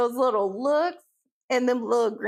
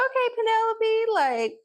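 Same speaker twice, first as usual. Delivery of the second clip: excited higher reply; 8 semitones higher than usual. Retold or imitated. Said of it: "I was like".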